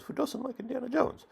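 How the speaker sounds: of course I love it; emphatic question. Retold or imitated. Quotes himself answering director.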